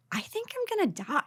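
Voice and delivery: high, soft mock-weak voice; hammed-up sickly whimper; retold feeble dying. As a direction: say it small and high, as if fading. Retelling falling ill with covid.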